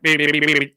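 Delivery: playful imitated beep noise. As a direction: beep like a machine to show she had nothing to say. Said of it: "she was just like".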